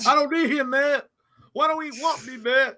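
high, tearful pleading; imitating Will's breakdown. Continuing the Fresh Prince breakdown impression, tearful. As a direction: cry out the questions high. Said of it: "he was like".

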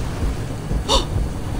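unvoiced gasping cry; frightened gasp. Real, not acted. Player jumping away from the monster, gasping in fear. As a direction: gasp in terror mid-jump.

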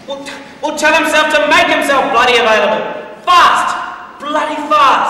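loud, high yelled orders down the line; angry demand. Acted. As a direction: demand it, hammering the last words. Officer yelling down the phone.

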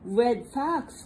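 ordinary delivery, nothing marked

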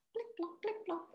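quiet, high plip plop of raindrops; soft high raindrop sounds; rain noise. Shown as a demonstration. Teacher imitates rain on school roof, soft and high. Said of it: "It's making that noise".

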